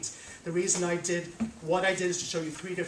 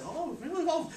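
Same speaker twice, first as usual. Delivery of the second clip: amazed workers; 6 semitones higher than usual. Acted. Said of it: "all of them said".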